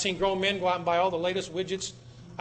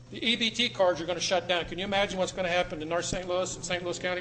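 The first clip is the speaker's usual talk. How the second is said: ordinary talk, nothing marked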